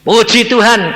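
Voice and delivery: high, loud declaration of praise. Retold or imitated. Son praising God at his mother's deathbed, proclaiming loudly. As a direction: proclaim it loudly over the farewell.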